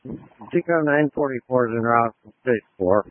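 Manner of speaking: crackly two-way radio; police radio. Real, not acted. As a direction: voice through a handheld radio. Fire unit radio transmission.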